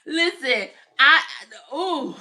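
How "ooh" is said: ordinary talk, nothing marked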